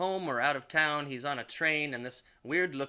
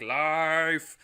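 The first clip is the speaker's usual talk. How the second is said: loud, higher, drawn-out mock demon; possessed demon cry; 4 semitones higher, 9 dB louder than usual. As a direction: belt it out as the demon-possessed wife. Possessed wife cries out.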